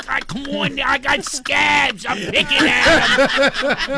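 put-on loud, high, drawn-out Philly accent; wicked Philly accent. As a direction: ham up a Philadelphia accent, stretching the key words. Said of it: "if he would have had a wicked Philly accent".

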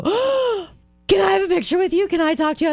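high, fast gushing fan. Retold or imitated. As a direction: overeager fan asking for a photo.

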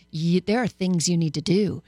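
ordinary talk

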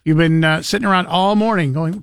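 usual speaking voice

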